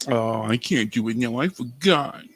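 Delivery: slow, put-on echo of the refusal; mocking mimicry. Host mimicking a reluctant friend, slow mocking echo. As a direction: draw it out, teasing imitation.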